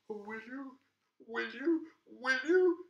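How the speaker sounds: a lonely monster hardly daring to hope; eager monster. Acted. Monster hopes for a friend.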